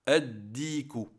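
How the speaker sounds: slow "Addiku" with a held double D; straight into shadda. Shown as a demonstration. Teacher demonstrates entering the shadda directly, long D in "Addiku". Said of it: "on rentre directement dans la shadda".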